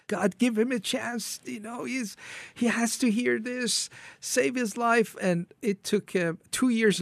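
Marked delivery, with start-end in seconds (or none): 0.1-5.2 high-pitched, urgent plea; pleading prayer. Retold or imitated. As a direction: beg God on a much higher pitch. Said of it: "I started praying".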